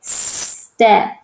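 "Step" with a drawn-out s; sounded-out word. Shown as a demonstration. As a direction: hold a long hissed s in "Step", then land on the rest. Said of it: "just listen to the sound".

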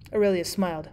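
usual speaking voice

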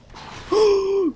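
high, loud gasp of surprise; startled gasp. Real, not acted. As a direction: gasp as the enemy charges. Enemy dares to come. (forskrækket gisp)

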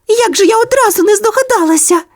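very high, gleeful little-dog cry; delighted. A narrator's reading. Sonya the dog realises the hatch is the moon, high glee. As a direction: burst out with sudden joy, very high. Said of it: "зраділа вона".

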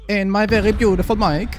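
high theatrical indignation; mock outrage. Streamer playfully defends his mic to Tommy, mock outrage. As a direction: act offended, pitched well up.

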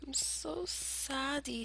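put-on whispered babyish sulk, slow; mock sad. Gamer sulking over a loss, whispering like a baby. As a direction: pout it out in a tiny whisper.

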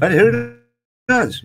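playful doubt. Real, not acted. Streamer muses to viewers on the young Sharks, playful. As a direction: toss it up lightly, unsure.

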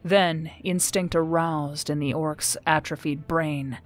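ordinary delivery, nothing marked

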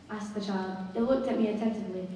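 usual speaking voice

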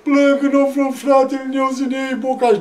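loud, high tearful complaint; imitated wailing explanation; mock crying. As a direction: bawl out your grievance like a child. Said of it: "De ce plângi".

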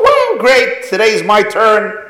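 put-on excited boy, high, loud and laughing; thrilled. Boy thrilled his Rebbe called on him, excited. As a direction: chirp it out like a proud kid.

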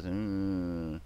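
a low, soft villain's hum; hint of a movie villain, lightly done; Doctor Evil. Mocking the US as Doctor Evil, a low villain hum. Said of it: "where he grabs his pinky and goes".

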